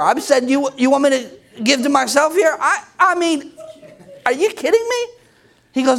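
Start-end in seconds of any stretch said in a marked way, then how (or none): 0.5-5.1 high-pitched, stammering incredulity; mock disbelief. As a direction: act out a hammed-up protest, climbing higher. Acts out disbelief at God's demand.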